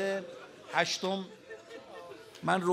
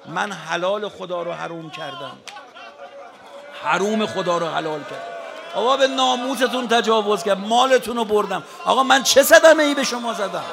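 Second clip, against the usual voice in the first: impassioned shout; 2 semitones higher, 12 dB louder than usual. Acted. Preacher voicing Hussein to his enemies, shouting. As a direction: voice swelling to a full preaching shout.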